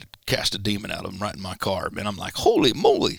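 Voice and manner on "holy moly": high, loud startled exclamation; holy moly surprise. Retold or imitated. Preacher recalling the demon in his car, startled exclamation. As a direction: blurt it out in shock.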